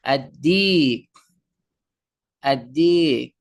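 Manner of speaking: "আদ-দিক" with a long doubled d and no l; slow teacher's demo of "আদ-দিক", lam dropped; silent lam. Shown as a demonstration. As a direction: hold the d and draw out "আদ-দিক". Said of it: "লামটা উচ্চারণ হবে না".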